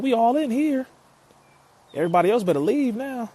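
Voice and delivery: high, loud, thrilled shout to the chat; excited shout. Real, not acted. Streamer greeting hometown fans in chat, high excited shout. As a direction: hype it up like the whole crowd showed up.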